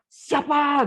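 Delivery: a sudden high, loud yell; the dying man bursts out in alarm; the old shopkeeper, suddenly shouting. Acted. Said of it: "dia teriak".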